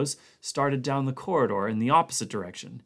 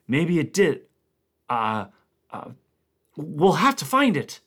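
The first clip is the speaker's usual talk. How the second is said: fear-struck; 5 semitones higher, 6 dB louder than usual. Acted. Blair suddenly fear-struck.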